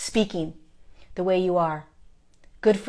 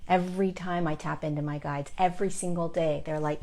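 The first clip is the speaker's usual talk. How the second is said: ordinary talk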